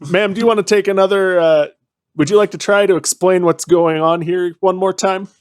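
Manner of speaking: mock-polite interrogation; mock polite cop. Player confronting woman.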